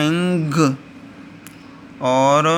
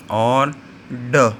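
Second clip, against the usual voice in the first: slow sounding out; much slower than usual. Shown as a demonstration. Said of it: "तो ये हमारे पास हो गया".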